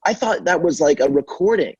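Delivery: surprised customer. Retold or imitated. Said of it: "they'd be like".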